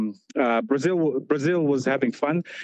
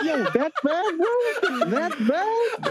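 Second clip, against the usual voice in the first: laughing tease; 13 semitones higher, much slower than usual. Retold or imitated. Teasing mate who broke screen.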